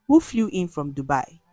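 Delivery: plain reading voice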